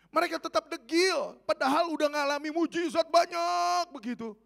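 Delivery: loud, high preaching outburst; exasperated exclamation. Real, not acted. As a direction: cry out at their stubbornness. Preacher exclaims over disciples' stubbornness. (seruan lantang penuh keheranan)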